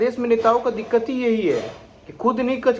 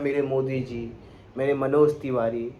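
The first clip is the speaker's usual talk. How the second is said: lower, softer mock-devoted voice; mock fawning; 6 semitones lower, 5 dB quieter than usual. Vlogger mimicking the politician's changed tone after joining BJP, mock fawning. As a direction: lightly imitate a newly converted party loyalist's reverence. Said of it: "ऐसे बोल रहे हैं जैसे लग रहा है वही उनके घर चलाते हैं".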